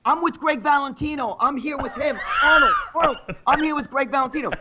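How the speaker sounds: high, excited mock name-dropping; imitated eager fan. Fan pestering Arnold at the sink.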